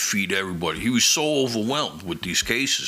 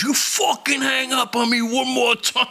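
threatening shout; 14 semitones higher than usual. Retold or imitated. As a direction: yell the threat word by word. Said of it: "He goes".

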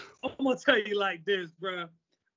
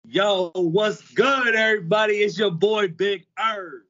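hype man intro; 8 dB louder than usual. Acted. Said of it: "our hype man hybrid".

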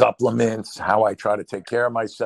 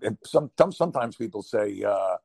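ordinary talk, nothing marked

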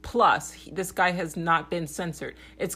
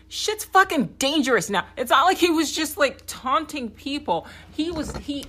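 heated outburst; 9 semitones higher, 5 dB louder than usual. Real, not acted. Heated rant about Trump's danger.

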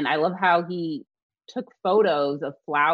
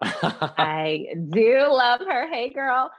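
drawn-out, higher-pitched gushing with a laugh; playful gushing; 8 semitones higher, 4 dB louder, much slower than usual. Real, not acted. Co-host teased about loving the designer, playfully gushing. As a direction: stretch it out fondly, then lift into a playful greeting.

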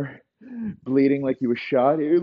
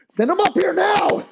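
high, loud, desperate scream; screamed order; 7 dB louder than usual. Acted. Elway screams for the ball boys.

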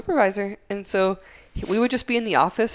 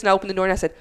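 ordinary delivery

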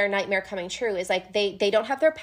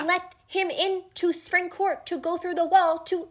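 much higher, outraged explaining; worked up; 8 semitones higher than usual. Real, not acted. Friend ranting to the others about Tamlin's bargain, high and worked up. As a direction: pile on the outrage.

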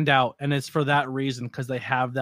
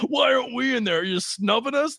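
high, whiny mock complaint; whiny promoter; 9 semitones higher than usual. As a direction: mimic a sulky promoter, hammed up.

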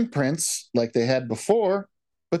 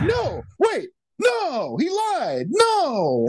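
mock panicked shout; 14 semitones higher, much slower than usual. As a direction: shout it like a frantic kid. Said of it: "he's like".

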